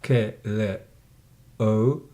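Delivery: "K", "Le", "O" each said alone; sound by sound. Shown as a demonstration. Teacher rebuilds word for pupil, separate "K", "Le", "O". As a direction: spell out the sounds "K", "Le", "O" one by one. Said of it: "how this word is pronounced".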